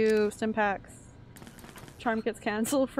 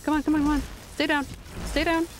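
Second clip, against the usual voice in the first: urgent combat shouting; 5 semitones higher, faster than usual. Real, not acted. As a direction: urge the enemy down in repeated bursts. Urging enemies to fall in combat.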